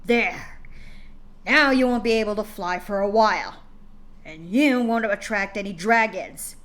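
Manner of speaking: father snapping at his crying daughter, stern and loud; harsh father. Acted. Father voiced in story.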